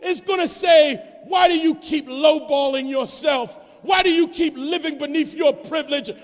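high, loud shouted sermon; impassioned preacher's shout with drawn-out key words; full-force preaching. Real, not acted. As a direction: hammer each phrase home to the congregation.

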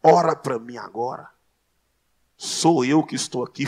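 low-pitched, loud command in another man's voice; dropped-pitch mock prophecy; lightly voiced false prophet. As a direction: imitate a man claiming to speak for God. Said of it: "e fala assim".

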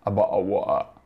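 low, slurred London mumble; Londoner's reply. Retold or imitated. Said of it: "la prima volta che io ascoltai".

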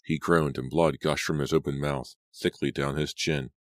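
plain reading voice

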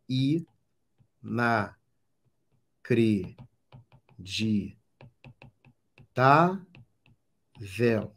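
extremely slow, one syllable at a time; separando as sílabas. Shown as a demonstration. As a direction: break "inacreditável" into syllables with long pauses. Separa sílabas para achar a tônica. ("inacreditável" silabado, bem devagar)

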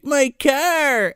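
high-pitched exclamation of loss; mock dismayed cry. Imitating Jake as his car sinks, mock dismay.

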